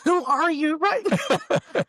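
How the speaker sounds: high squeaky laughing disbelief; giggling fake wife shriek; laughing mock outrage. Acting wife's shocked outburst.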